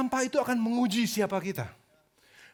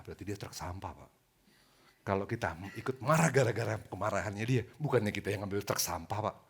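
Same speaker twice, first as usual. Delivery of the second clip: very calm, much lower and much softer; calm taxi driver; 14 semitones lower, 10 dB quieter than usual. Retold or imitated. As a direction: talk the angry passenger down gently, far lower and softer. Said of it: "Supir taksinya kalem banget".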